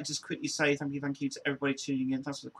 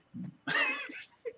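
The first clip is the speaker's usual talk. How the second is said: very high giggle; high-pitched laughing fit; squealing laugh. Real, not acted. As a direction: giggle uncontrollably.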